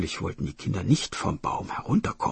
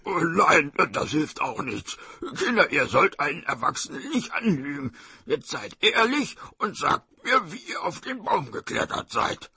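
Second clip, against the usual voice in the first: exasperated tiger voice, pitch climbing; scolding tiger; 7 semitones higher than usual. Acted. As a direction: scold the children as a frustrated tiger.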